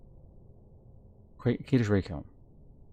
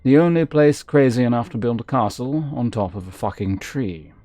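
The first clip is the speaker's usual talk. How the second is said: lively read-aloud narrator voice; storybook reading, a touch dramatic; story narration; 4 semitones higher, 5 dB louder than usual. Acted. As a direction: read the book out like telling a tale. Reads game book aloud.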